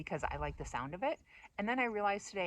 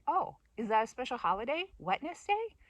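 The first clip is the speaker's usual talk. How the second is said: high, rising question; baffled echo of an odd word; acted puzzlement; 7 semitones higher, 4 dB louder than usual. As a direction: play innocently confused.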